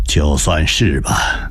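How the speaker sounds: low, slow, unbothered reply; coolly calm. Acted. Husband coolly admits the title to his wife, unbothered. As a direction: shrug it off coolly. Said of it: "孙延龄冷静地回道".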